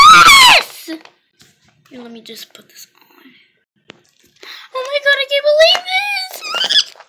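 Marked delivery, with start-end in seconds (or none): 0.1-0.9 high ecstatic shout; dawning joy. Pretend. Accepting the proposal. 4.7-6.9 loud high overjoyed squeal; dawning joy. Pretend. Reacting to engagement.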